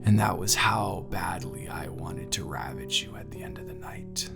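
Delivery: soft, intimate whisper fading away; hushed desire. Acted. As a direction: confess it under your breath. Confessing desire to partner.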